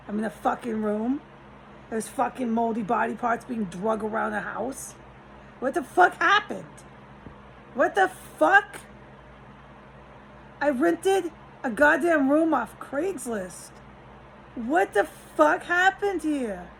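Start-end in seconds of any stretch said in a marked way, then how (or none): 5.6-16.7 louder and higher, slow disbelieving yell; exasperated outburst. Real, not acted. Ranting to viewers about his Craigslist room, in a loud slow exasperated outburst. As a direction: demand an answer from the universe.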